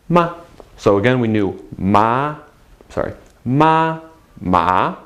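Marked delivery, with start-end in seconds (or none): 3.4-4.0 "ma" held high and level; first tone ma. Shown as a demonstration. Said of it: "the four tones in Chinese". 4.4-5.0 "ma" rising like a question; second tone ma. Shown as a demonstration. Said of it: "the four tones in Chinese".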